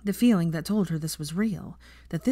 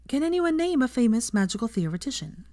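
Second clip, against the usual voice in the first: a touch higher, brisk classroom address; a professor ending her lesson, lightly voiced teacher; 6 semitones higher than usual.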